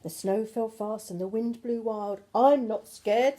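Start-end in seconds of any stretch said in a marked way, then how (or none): 2.3-3.4 louder, slow brave little voice; slow, loud declaration of courage; brave child. Acted. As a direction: puff up to sound brave. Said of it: "said the Gruffalo child".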